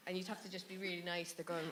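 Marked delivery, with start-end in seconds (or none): none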